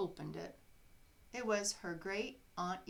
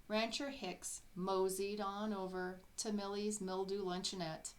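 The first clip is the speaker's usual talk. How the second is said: ordinary delivery, nothing marked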